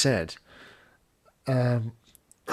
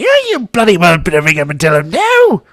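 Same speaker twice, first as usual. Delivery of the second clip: higher, much louder bossy demand; high Daisy voice; 6 semitones higher, 11 dB louder than usual. Retold or imitated. Daisy insisting he ring the postman now, loud and high. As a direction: order him about, loud and high. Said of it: "I says".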